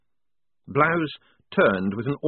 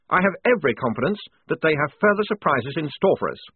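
higher-pitched defiant lieutenant; emphatic, rather nervous officer; nervous defiance; 7 semitones higher, 4 dB louder than usual. A narrator's reading. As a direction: stand by your troops, nervily. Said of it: "shaking with nervous defiance".

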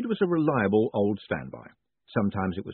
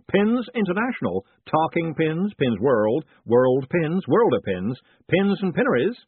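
loud, higher, eager salesman; pin-shop man; 6 semitones higher, 6 dB louder than usual. Acted. Pin seller listing magazines to a customer, loud sales patter. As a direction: reel off the stock loudly and brightly.

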